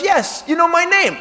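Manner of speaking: lightly imitated woman, pitched high; woman caller. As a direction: answer as the pleased woman on the phone. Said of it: "It's a woman".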